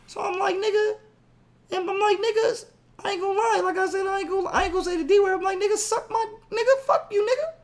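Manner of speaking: very high-pitched, incredulous protest; heated retelling. Retold or imitated. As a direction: shoot way up high while retelling the argument. Retold furious phone argument.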